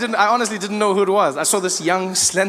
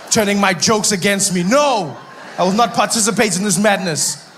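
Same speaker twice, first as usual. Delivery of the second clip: usual speaking voice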